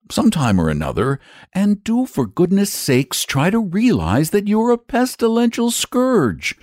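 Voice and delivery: put-on high-pitched boy, urgently scolding; exasperated boy. A narrator's reading. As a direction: take leave as a fed-up boy. Boy leaves, scolding the dragon.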